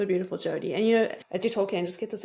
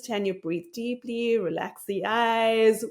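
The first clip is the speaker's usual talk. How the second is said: slow, soothing reminder to herself; calm self-talk; slower than usual. Pretend. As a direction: talk yourself down gently, breath by breath.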